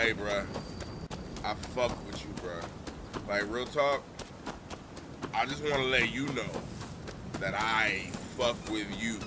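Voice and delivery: mock-sincere drunk bro; drunk affection. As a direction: lean in and tell your bro you love him.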